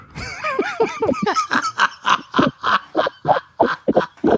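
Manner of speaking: long, high-pitched cackling; real laughing fit. Real, not acted. Teasing friend about getting water, helpless laughter. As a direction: lose it laughing. Laughing at his own question.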